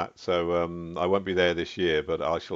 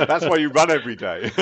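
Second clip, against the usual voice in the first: said through real laughter, higher and louder; chuckling while teasing a colleague; laughing; 7 semitones higher, 9 dB louder than usual. Real, not acted. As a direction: laugh the tease out. Host teases guest about food.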